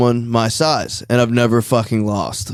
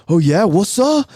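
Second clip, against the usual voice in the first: slow, higher, puffed-up challenge; cringy tough guy; 6 semitones higher than usual. Retold or imitated. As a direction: act like a tall guy squaring up, trying to look tough. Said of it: "Like, like, literally like that".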